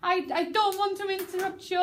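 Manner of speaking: high-pitched apologetic begging; pleading character. Shown as a demonstration. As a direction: demonstrate an imploring apology. Teacher demonstrates the pleading line.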